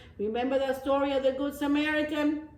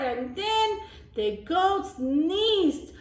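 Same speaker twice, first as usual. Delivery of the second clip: slow build-up; 7 semitones higher, slower than usual. A narrator's reading. Build-up to the sneeze.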